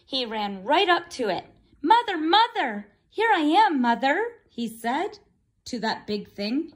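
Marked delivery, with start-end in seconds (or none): 1.8-4.4 high, louder calling out; eager calling chick. Acted. Bird running to the big thing, high eager calling. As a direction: call out joyfully.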